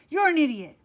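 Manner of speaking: loud, cutting growl at Chet; angry growl. A narrator's reading. Sid furious at Chet after the prank, growling. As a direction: spit the insult. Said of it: "Sid growled".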